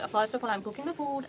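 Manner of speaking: normal talking voice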